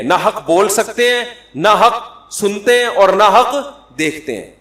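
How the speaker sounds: loud, high, insistent sermon; impassioned preacher's rebuke; fiery preaching. Acted. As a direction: press the congregation hard. Preaching at full force.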